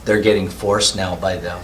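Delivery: ordinary talk, nothing marked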